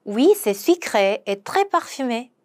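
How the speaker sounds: very slow, careful French; slow pronunciation model; slow demo. Shown as a demonstration. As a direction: lay out each syllable slowly for learners. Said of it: "한 번 더".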